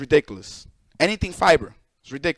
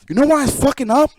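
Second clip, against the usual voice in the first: pressing question, much higher and louder; heated question; 5 semitones higher, 4 dB louder, faster than usual. Real, not acted. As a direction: demand they answer why it breaks. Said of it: "repeated with more force".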